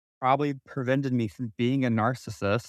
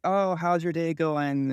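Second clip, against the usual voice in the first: higher, slower fake-sweet voice; imitating mom's sugary act; mock nice; 6 semitones higher, slower than usual. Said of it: "trying to be nice".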